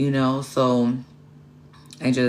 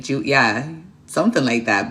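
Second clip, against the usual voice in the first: ordinary talk, nothing marked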